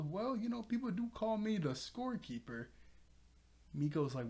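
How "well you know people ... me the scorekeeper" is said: high-pitched, airy bragging; mock boastful, pitched well up; self-important boast. Nyx boasting about self-given nickname.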